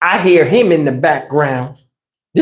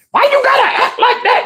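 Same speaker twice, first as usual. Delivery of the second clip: shrieking son. Retold or imitated. Son yelling back at granddad.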